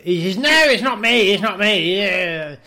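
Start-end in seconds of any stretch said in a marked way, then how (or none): 0.4-2.0 much higher, louder squealed denial. Retold or imitated. [name] squealing denial to the caller on camera. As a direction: squeal it like a caught-out star.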